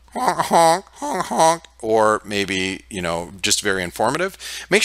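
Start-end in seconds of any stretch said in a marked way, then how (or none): 0.1-1.6 high honking clown horn noise; silly clown. Pretend. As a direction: honk like a clown's bike horn. Clown horn after "entertaining.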